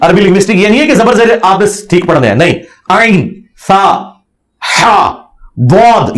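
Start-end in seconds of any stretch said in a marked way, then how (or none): 2.9-6.2 Arabic letters sounded slowly one by one, "عین، سا، ہا، باد"; careful Arabic articulation. Shown as a demonstration. Teacher showing which letters must come out correctly, careful Arabic articulation. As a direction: draw out each of "عین، سا، ہا، باد" from its proper place in throat and mouth. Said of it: "یہ صحیح نکلنا چاہیے".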